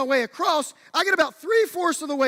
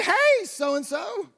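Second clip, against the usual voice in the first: slow, drawn-out call, pitched much higher with a laugh; calling over; 8 semitones higher, slower than usual. Retold or imitated. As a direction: coax her across with a long bright call. Calling to scared girl on course.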